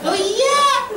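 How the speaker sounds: high, loud exclamation; emphatic outburst. Real, not acted. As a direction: burst out loudly to drive it home.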